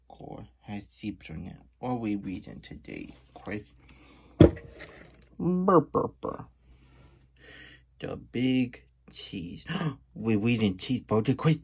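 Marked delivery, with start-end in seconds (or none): 5.4-6.4 bright rolled burr; high chirpy burr. Acted. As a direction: trill it high and quick. Character chirping sound.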